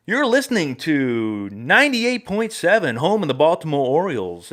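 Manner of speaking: loud, slow radio announcer sing-out. Retold or imitated. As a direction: belt out a stretched station ID. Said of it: "I went into my radio voice".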